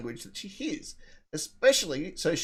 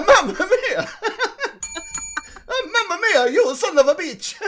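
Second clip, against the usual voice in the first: put-on loud, high, hammy Italian accent; 12 semitones higher, 6 dB louder than usual. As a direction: belt it out in a cartoon Italian accent. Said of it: "That was my Mexican guy".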